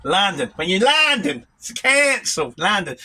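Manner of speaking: broad London pronunciation of London; London accent. Shown as a demonstration. Answering a viewer about his London accent, in broad London. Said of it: "your accent's stronger when you're in London".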